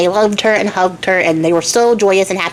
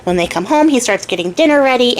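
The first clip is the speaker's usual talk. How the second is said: normal talking voice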